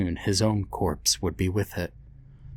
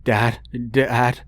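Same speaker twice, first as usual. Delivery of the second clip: stammered; 4 semitones higher, 4 dB louder than usual. Acted. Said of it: "Ben stammered".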